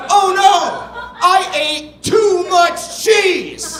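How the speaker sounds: high, slow, drawn-out storybook wail; hammed-up dismay. Author reads Tommy's cheese disaster aloud, hammed-up dismay. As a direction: wail it like a pug who overdid it.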